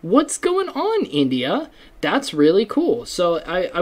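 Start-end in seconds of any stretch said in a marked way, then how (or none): 0.0-1.7 high, bright-eyed greeting to listeners; upbeat shout-out. Real, not acted. Podcaster greets French listeners with a high cheery call.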